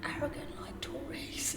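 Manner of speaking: whisper. Real, not acted. Cheeky aside about politicians.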